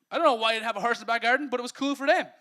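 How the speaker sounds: quick loud amused aside; excited. Real, not acted. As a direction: rattle it off with a grin. Excited aside about the horse.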